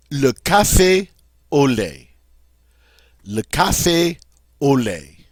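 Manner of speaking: "Le café au lait" with the stress on the final syllable; crisp French "café au lait", no English glide; French pronunciation. Shown as a demonstration. Teacher modelling "Le café au lait" twice. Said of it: "or café au lait".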